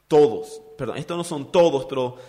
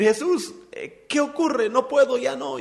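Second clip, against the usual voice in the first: high, strained cry of panic; sinking Peter; 9 semitones higher than usual. Retold or imitated. Peter sinking in the waves cries to Jesus, high panicked. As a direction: voice Peter going under, much higher. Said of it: "se fatigó el pobre y comenzó a hundirse".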